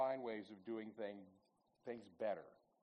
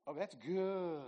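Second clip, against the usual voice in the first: imitated Southern wife, higher-pitched; Southern accent; 5 semitones higher, slower than usual. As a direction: draw out the approval in a Southern accent. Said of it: "say that in that Southern accent".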